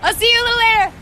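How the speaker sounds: loud, high shout to someone leaving; calling out. Real, not acted. Host seeing off the costumer, calling out loudly. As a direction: call it out across the distance.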